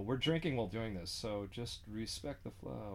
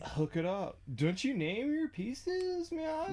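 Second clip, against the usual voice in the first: high, slow stoned squeak; stoner voice; 3 dB louder, slower than usual. Pretend. Stoner character asks about bong.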